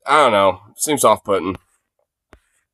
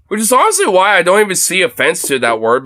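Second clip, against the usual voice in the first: normal talking voice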